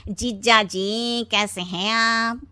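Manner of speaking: chuckle, then very slow, stretched greeting; oily brother-in-law. Acted. Wife's brother greeting his brother-in-law, drawling ingratiatingly. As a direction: drag out every word in a put-on voice.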